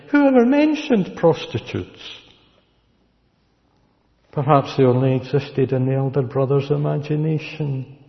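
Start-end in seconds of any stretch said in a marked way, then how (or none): 0.1-2.0 high incredulous question. Shown as a demonstration.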